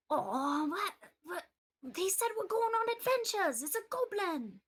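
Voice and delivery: put-on eager high boy protesting; a kid who can't believe he's stopped; high child's voice. Boy protesting to the dwarf, high and eager.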